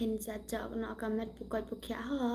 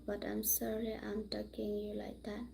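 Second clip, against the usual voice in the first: whispered apology; hushed, barely voiced sorry; 2 semitones lower, 9 dB quieter than usual. Real, not acted. Apologising to a viewer in a whisper.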